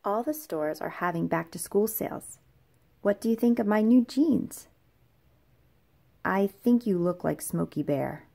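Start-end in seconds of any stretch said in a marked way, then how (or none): none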